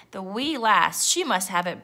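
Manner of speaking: ordinary delivery, nothing marked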